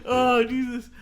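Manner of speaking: high-pitched words broken by real laughter; laughing helplessly while protesting. Real, not acted. Co-host laughs through protest at the teasing.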